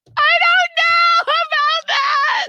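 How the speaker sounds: drawn-out, very high-pitched yell; re-enacted yelling. Upset speaker to their person about installments, yelling. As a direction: yell it full out, stretching the words. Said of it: "then I'm yelling at him".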